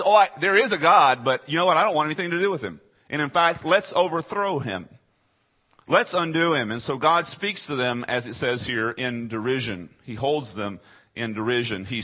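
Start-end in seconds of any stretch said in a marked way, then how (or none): 0.0-6.7 raised, loud mock rebellion; mock defiant atheist. Preacher voicing atheists rejecting God, mock defiant and loud. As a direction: play the defiant atheist shaking his fist.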